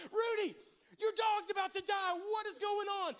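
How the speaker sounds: loud, high panicked phone call; freaking out. Retold or imitated. Dog sitter calling the owner about the dog, freaking out. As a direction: shout it in comic panic. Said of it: "So I'm freaking out".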